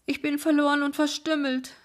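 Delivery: high, loud despairing cry. Acted. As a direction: wail it out in distress. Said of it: "rief sie aus".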